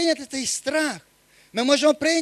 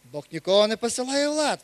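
ordinary delivery

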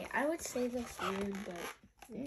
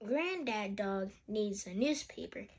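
usual speaking voice

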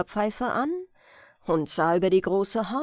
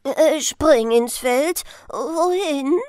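slow, louder startled grandfather; sleepy startled grandfather; 4 semitones higher, 6 dB louder, slower than usual. Acted. Grandfather startled from his nap, sleepy and louder. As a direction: jolt awake and call him back. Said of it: "fuhr verschlafen hoch".

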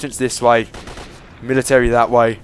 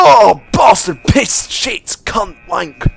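frantic swearing; 8 semitones higher, 7 dB louder, much slower than usual. Real, not acted. Swearing after being caught.

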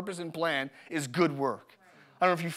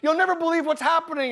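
much higher, louder, frantic imitation of wife; mock upset wife; 12 semitones higher, 4 dB louder than usual. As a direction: play the panicked wife pounding on the door. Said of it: "she's emotional, and she's banging on the door".

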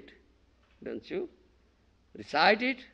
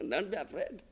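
ordinary delivery

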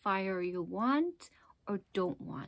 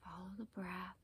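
guided meditation; 8 dB quieter than usual. Acted. Teacher guiding listeners to focus on the breath, calm meditation voice. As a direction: ease them gently into their breath, unhurried.

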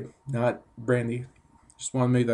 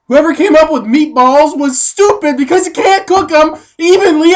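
high-pitched excited rant; voice got so high; 8 semitones higher, 4 dB louder than usual. Real, not acted. Pitching meat squares to the camera, voice squeaking high with excitement. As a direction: pitch way up, giddy with the idea. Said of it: "why my voice got so high there".

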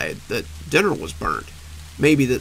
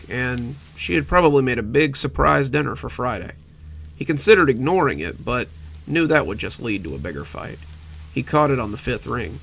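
ordinary delivery, nothing marked

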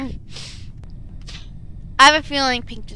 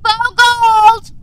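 urgent shouting; 8 semitones higher, 9 dB louder, much slower than usual. Real, not acted. Urgent calling out in meeting.

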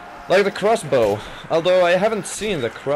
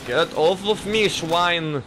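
higher, put-on German protest; badly faked German accent, pitched up; 5 semitones higher, slower than usual. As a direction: do a cartoon German, indignant. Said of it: "I can't do a German accent".

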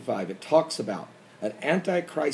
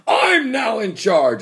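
mock Putin shout; 6 semitones higher, 6 dB louder, slower than usual. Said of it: "He'll say".

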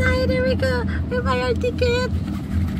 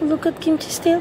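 normal talking voice

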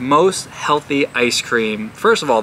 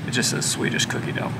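whispered aside; 7 dB quieter, faster than usual. Real, not acted. Aside under breath.

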